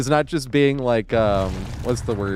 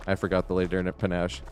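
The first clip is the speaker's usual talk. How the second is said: mumbled, much softer and lower; sheepish quiet admission; 6 semitones lower, 7 dB quieter than usual. Retold or imitated. The bellboy admitting the mistake, mumbled softly. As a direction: own up meekly under the breath. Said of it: "And he's like".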